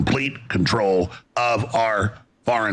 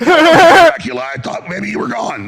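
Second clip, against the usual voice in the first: shrieking mock fright, much higher and louder; 13 semitones higher, 10 dB louder than usual. Host pretends to greet Count Dracula, squealing higher and louder. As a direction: squeal it like a startled victim. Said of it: "I'm like".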